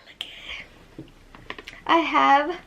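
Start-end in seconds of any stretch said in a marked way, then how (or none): 0.1-1.1 voiceless, hushed whisper; excited whisper. Real, not acted. Showing viewers the palette, excited whisper. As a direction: whisper it as if overcome.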